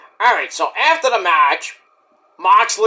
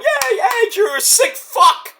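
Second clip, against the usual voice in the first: much higher, cheery crowd chant; mock cheering fans; slower than usual. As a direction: cheer it like a fan. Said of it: "The fans are going".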